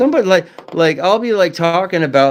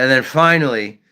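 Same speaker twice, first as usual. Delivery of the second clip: normal talking voice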